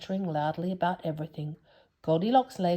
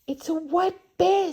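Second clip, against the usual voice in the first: high, a touch of alarm; half frightened; 13 semitones higher, 5 dB louder than usual. Acted. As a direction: gasp it out, half scared. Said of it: "half frightened".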